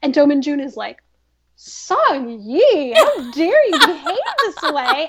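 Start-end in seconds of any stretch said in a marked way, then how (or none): 1.6-5.1 slow, hammed-up reproach; mock scolding. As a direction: imitate someone scandalized, stretching the words out. Acting Do Min Jun's scolding.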